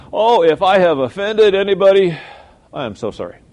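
loud, high, hammed-up insincere sorry; mock apology. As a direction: sing out a showy fake apology. Mocking a fake church apology.

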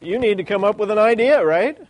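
mock push to come up with a plan; Jesus prodding Philip. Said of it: "It's like".

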